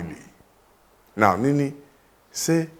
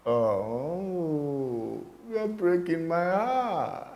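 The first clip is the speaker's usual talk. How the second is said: mock heartbreak; 3 semitones higher, 6 dB quieter, much slower than usual. Pupil cannot answer the teacher.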